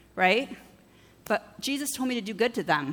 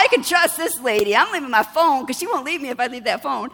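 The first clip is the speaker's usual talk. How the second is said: louder, knowing voice; stranger's scheming thought; 3 semitones higher, 6 dB louder than usual. Retold or imitated. Woman deciding to leave her phone, louder and knowing. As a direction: plot it out confidently. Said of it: "She thought".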